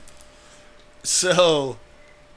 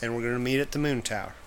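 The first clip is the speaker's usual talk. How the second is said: McConaughey impression; 5 dB quieter than usual. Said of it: "Matthew McConaughey of cars".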